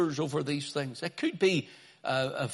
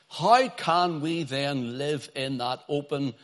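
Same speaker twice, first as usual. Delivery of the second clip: normal talking voice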